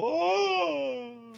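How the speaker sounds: long, high mock wail; imitated stunned cry. He's just all shocked after the slap, imitated cry. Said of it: "He's just all".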